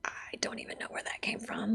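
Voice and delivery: a stunned whisper to the translator. Retold or imitated. She whispers to the translator, stunned, mid-prayer. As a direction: breathe it out under your breath, baffled.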